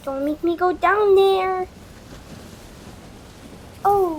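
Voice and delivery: ordinary talk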